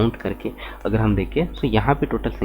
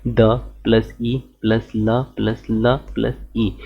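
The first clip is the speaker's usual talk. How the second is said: slow, deliberate letter-by-letter breakdown; slow spelling out; slower than usual. Shown as a demonstration. Teacher splitting Delhi into letters, slow and deliberate. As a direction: spell each letter out slowly. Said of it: "जब आप वर्ण विच्छेद करोगे".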